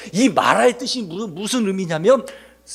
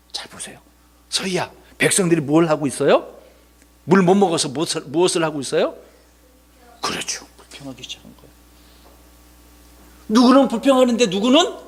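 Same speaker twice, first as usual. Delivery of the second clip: normal talking voice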